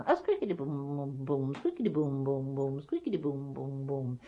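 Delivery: soft chanted shuttle rhythm; weaving loom noise. Acted. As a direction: imitate a loom working steadily, quietly. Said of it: "and a weaving".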